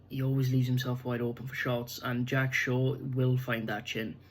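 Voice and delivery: normal talking voice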